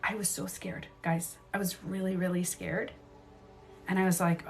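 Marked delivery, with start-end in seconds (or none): 0.0-2.9 very soft, near-whispered admission; hushed confiding. Real, not acted. Admitting fear to viewers, hushed and confiding. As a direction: confide it under your breath.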